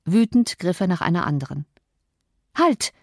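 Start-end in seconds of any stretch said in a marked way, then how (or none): 2.6-2.9 calling out. Acted. Cathy stops her cousin, calling out. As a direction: stop them with a high call. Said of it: "rief sie".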